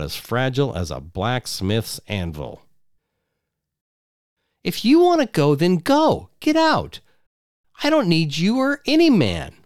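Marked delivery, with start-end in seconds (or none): 4.6-9.6 high, loud furious wife voice; angry wife. Acted. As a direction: throw him out at the top of her voice. Wife in a shouting quarrel.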